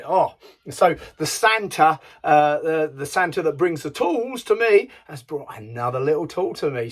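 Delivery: ordinary delivery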